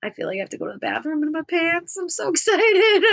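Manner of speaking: high, fast, laughing squeal of excitement; breathless mock-giddy fan. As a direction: gush like a starstruck fan. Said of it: "I'm just sitting there like".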